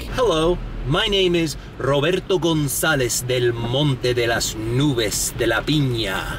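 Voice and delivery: mock self-introduction in exaggerated Spanish pronunciation. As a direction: flaunt the Spanish accent on the long name.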